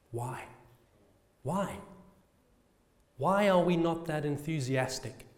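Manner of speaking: normal talking voice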